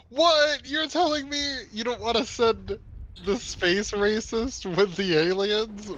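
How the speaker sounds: high, loud, exaggerated disbelief; mock incredulity. Teasing a co-player over the squad pick, mock incredulous. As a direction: play up being scandalised, pitched well up.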